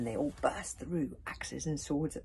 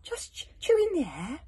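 innocent dragon; 10 semitones higher, 9 dB louder than usual. A narrator's reading.

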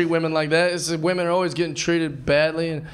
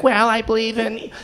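pithy date guy; 4 semitones higher than usual. Acted. Acting a pithy weird date.

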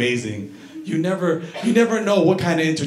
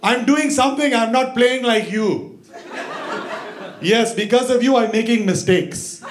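barber yelling at wife; 8 semitones higher than usual. Retold or imitated. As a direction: snap back at his nagging wife. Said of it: "his wife's yelling".

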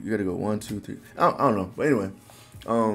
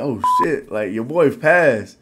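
loud, raised-pitch retold triumph; hyped realization; 5 semitones higher, 9 dB louder, slower than usual. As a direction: act out celebrating a pass, stretching it out. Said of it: "So I'm just like".

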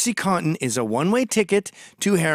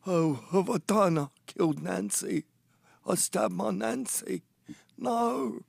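soft, stunned anguish, lightly acted; a hushed moan of disbelief; grieving Sid; 4 semitones higher, 5 dB quieter, slower than usual. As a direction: Sid mildly acted waking to find Nancy dead. Sid finds Nancy dead.

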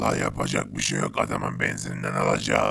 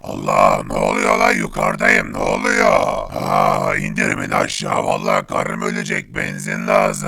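a tough robot with no tune in the voice; gruff toneless robot; 7 dB louder than usual. Acted. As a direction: speak like a machine, threatening.

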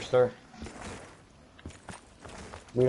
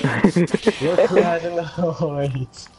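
slow, drawn-out teasing repeat; mocking echo; 4 semitones higher, 5 dB louder, much slower than usual. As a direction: stretch every word out mockingly. Teammate echoes the tease back.

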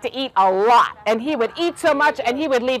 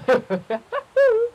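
high, acted snickering to himself; scheming little storybook laugh; sly lion chuckle; 9 semitones higher than usual. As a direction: chuckle as if plotting.

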